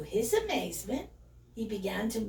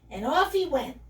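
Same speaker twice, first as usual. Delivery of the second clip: ordinary delivery, nothing marked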